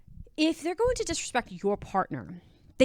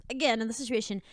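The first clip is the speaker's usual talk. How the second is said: ordinary talk, nothing marked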